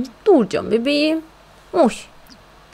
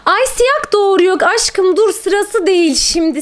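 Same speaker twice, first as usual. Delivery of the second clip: high, loud, rushed panic; excited alarm; 9 semitones higher, 3 dB louder than usual. Real, not acted. Player panicking that her cat is giving birth, high excited squeal. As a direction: squeal it out as the cat goes into labour.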